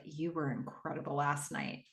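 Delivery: soft, low, intimate line; sultry murmur. Retold or imitated. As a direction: say it close and soft for all to hear. Cam faking intimacy publicly.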